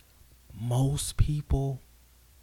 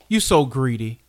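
heated rant; 4 semitones higher, 7 dB louder than usual. Real, not acted. Ranting at the NFL.

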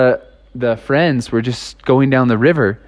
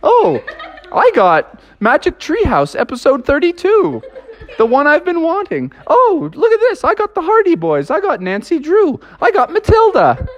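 much higher, giddy, laughing through it; excited kids; 12 semitones higher than usual. Retold or imitated. Friends showing each other their books, high and giddy. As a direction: squeal over each book like a prize.

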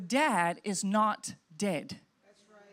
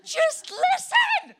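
high, slow, exasperated mock yell; 6 dB louder, much slower than usual. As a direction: shout at the screen like a fed-up viewer. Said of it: "I'm the one yelling at the T V".

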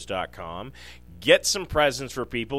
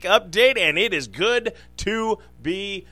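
radio announcer; 4 semitones higher, 5 dB louder than usual. Acted. Show opening announcer read.

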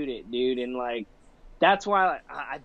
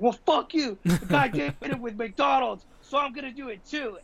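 higher-pitched mock defiance, a lightly voiced drunk; defiant drunk guy; 8 semitones higher than usual. Skit guy tells off his doctor, high mock defiance. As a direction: toss it back at the doctor like a stubborn fool.

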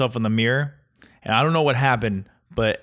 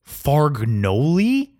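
very slow, higher, rising disbelief; incredulous; 5 semitones higher, 3 dB louder, much slower than usual. Acted. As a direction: stretch it into a baffled question. Mocking the invented pasta name.

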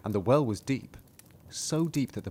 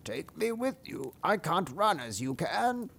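frog voice; 6 semitones higher, 4 dB quieter than usual. Acted.

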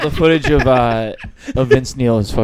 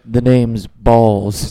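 slow suave spy voice; 2 semitones lower, 4 dB louder, much slower than usual. Pretend. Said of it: "my dick's like".

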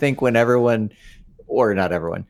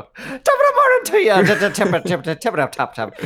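put-on high, laughing Irish accent; 5 semitones higher than usual. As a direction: chirp it like a cheery Irishman. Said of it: "freeing to do an Irish accent".

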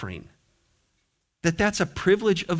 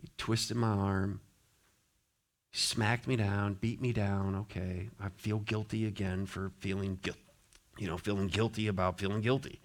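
resigned mock mutter; mock guilty mumble; 6 semitones lower, 10 dB quieter than usual. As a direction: lower and much softer, beaten down. Mock guilt-tripped listener.

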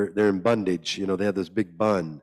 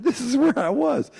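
much higher, eager exclaim; excited recognition; 3 dB louder than usual. Retold or imitated. Thirteen-year-old reading the book, much higher and excited. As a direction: shout out as if recognizing yourself. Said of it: "I said".

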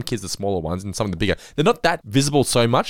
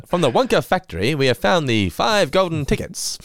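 news reporter; 7 semitones higher than usual. Retold or imitated. News guy reporting from the Wonka factory, high announcer voice. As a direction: announce it like a vintage news bulletin. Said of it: "That's what the news guy sounds like".

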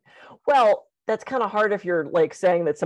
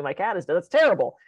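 ordinary talk, nothing marked

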